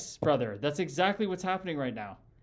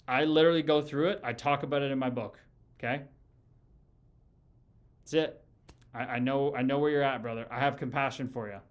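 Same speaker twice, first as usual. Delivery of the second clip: ordinary talk, nothing marked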